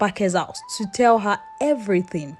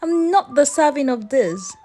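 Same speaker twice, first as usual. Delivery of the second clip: shocked outcry; 7 semitones higher than usual. Acted. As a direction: cry out in disbelief at the gift. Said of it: "Asabi screamed".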